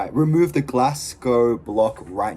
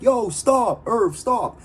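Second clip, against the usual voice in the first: higher, urgent mock plea to stop; pleading imitated call; retold plea; 9 semitones higher, much slower than usual. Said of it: "He's like".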